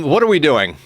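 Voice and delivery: ordinary talk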